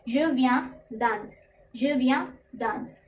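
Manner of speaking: "je viens दांत" spoken slowly, each syllable drawn out; "je viens दांत" slow and careful, sound by sound; slow pronunciation model. Shown as a demonstration. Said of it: "तो इसकी pronunciation होगी".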